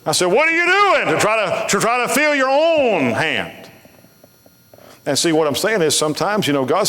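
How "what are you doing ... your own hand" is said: high, drawn-out preacher's cry; retold exhortation. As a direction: call it out high, stretching the key words. Acted rebuke retold to conference.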